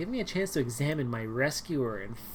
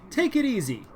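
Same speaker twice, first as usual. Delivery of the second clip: louder, firmer agent calming her; rescuer voice; 7 semitones higher, 6 dB louder than usual. Acted. Leon calming the panicking girl, firm and louder. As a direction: talk her down like a tough agent.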